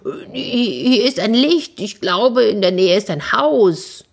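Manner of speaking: excited call without real shouting. Acted. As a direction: call news down to your friends. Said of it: "rief er den anderen zu".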